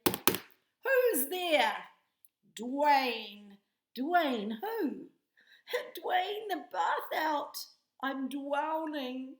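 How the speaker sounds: loud, high hammed-up joke retelling; squealing mock-panic punchline; knock knock joke again. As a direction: retell it big for the children. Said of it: "let's try that again".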